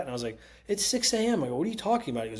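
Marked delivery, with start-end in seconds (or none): 0.7-1.4 exasperated protest. Retold or imitated. Arguing with dad at drive-thru. 1.6-2.2 incredulous. Retold or imitated. Arguing with dad at drive-thru.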